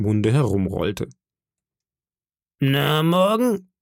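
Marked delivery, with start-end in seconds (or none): 2.6-3.7 slow, higher-pitched questioning; very serious. Acted. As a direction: open a stern interrogation, drawing it out. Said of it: "sagte Long John sehr ernst".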